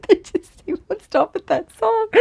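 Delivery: high giggly laugh and words. Real, not acted. Laughing at the spirits who won't stop their song. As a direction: crack up mid-line.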